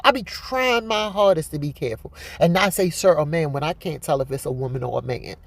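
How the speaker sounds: normal talking voice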